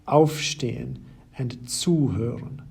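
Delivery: aufstehen stressed on auf, zuhören stressed on zu; stress on the prefix. Shown as a demonstration. Teacher to German learners, stressing the prefix. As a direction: hit the separable prefix in each verb. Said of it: "have their stress on the separable prefix itself".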